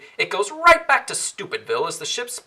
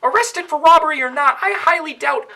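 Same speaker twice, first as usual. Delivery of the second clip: heated rant; 10 semitones higher, 6 dB louder than usual. Acted. Rant about the villains continues.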